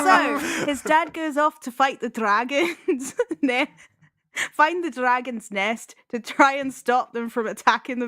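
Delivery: badly faked Scottish accent, giggling. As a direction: have a shaky go at a Scottish accent. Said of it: "say the whole synopsis in a Scottish accent".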